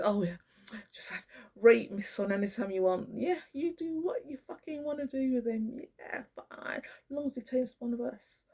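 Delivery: sarcastic high mock of a careless dad; mock father's voice. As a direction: sneer it out as the father giving his son away. Mocking the father handing over son.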